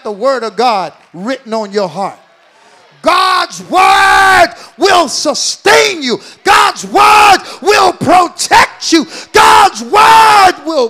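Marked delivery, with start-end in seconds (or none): 3.0-10.9 slow, high, shouted proclamation; preaching at full force. Real, not acted. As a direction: proclaim each word, drawn out.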